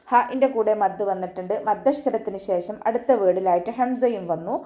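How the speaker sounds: ordinary delivery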